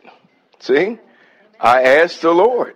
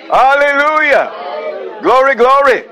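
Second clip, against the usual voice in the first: ecstatic praise; 10 semitones higher, slower than usual. Real, not acted. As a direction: let the Spirit lift you into shouted praise.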